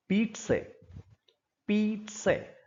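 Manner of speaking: पीट से said as peet-se; careful Italian-style पीट से. Shown as a demonstration. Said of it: "ये शब्द होता है पीट से". (पीट से, इटालियन उच्चारण में)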